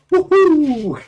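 loud, very high drawn-out woohoo; celebratory whoop. Pretend. Streamer celebrates the mock auction sale, high whoop. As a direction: whoop high to cap the announcement.

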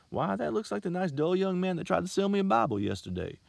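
chatty and pleased; older lady's chatter. A narrator's reading.

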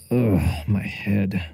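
soft, pained morning-after grumble; hungover groan. Acted. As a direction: groan it through a hangover. Hungover narrator wakes up.

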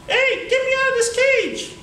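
high, cartoonish little monkey voice; talking monkey. Caged monkey begging the boy to free him, high voice. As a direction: squeak it out like a tiny caged monkey.

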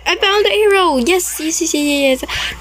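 high, triumphant yes yes yes; real relief and joy; excited. Real, not acted. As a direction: celebrate finding the way out. Finds escape arrow.

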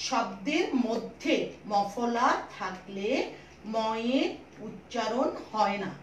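very slow, word by word; slow dictation. Shown as a demonstration. Teacher making sure pupils grasp the rule, slow dictation. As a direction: spell out the rule slowly for note-takers. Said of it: "দুই number নিয়মটা একটু ভালো করে বুঝে নাও".

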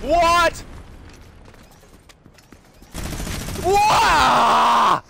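loud, high yell of disbelief; stunned gamer yelling at the screen; shocked shout. Real, not acted. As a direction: blurt it out at full volume. Stunned outburst at game events.

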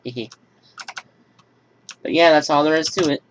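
ordinary delivery

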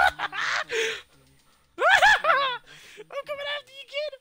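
high falsetto threat; mock menace. Killer hunting a kid who got away, squeaky mock menace. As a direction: threaten them in a giggly squeal.

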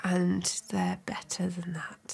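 slow breathy aside whisper. Real, not acted. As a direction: lean in and whisper it. Aside whisper about the pie.

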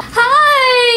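high excited Southern auntie; chirpy country aunt greeting; Aunt Betty Sue voice. Acted. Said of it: "my Aunt Betty Sue".

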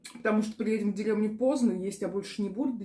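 eating while talking; chewing food. Real, not acted. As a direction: mouth partly full. Seen 4: fork in hand; lips pursed, cheeks moving over plate early on.

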